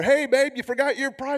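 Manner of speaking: high-pitched calling out; mock holler. Dad calling to daughter who forgot her project, mock holler. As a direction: call after someone leaving, without full volume. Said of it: "and I holler at her".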